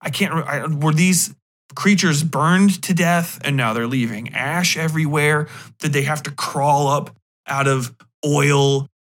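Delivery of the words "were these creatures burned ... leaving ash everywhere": usual speaking voice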